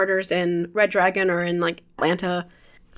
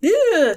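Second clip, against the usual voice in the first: high, loud mock "ew"; a squeamish grossed-out "ew"; disgusted ew; 10 semitones higher, 5 dB louder than usual. Expected disgusted reaction.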